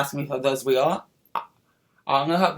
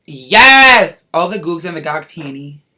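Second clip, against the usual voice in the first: loud triumphant yes; sassy drag queen; 14 dB louder than usual. Real, not acted. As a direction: cheer it like a diva. Celebrating finished lips.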